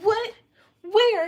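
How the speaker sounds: high, loud startled questions; mock shock. Streamer hearing the idol has vanished, in mock shock. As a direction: shout it in hammed-up disbelief. Acts out own shocked reaction.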